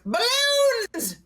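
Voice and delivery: very high shouted single word; yelled blurt. Retold or imitated. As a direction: holler the line as dad did. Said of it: "he yells it out".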